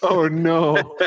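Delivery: high, laughing "oh no"; amused groan through a laugh; real laughter. Real, not acted. Laughing at cyber Balrog joke.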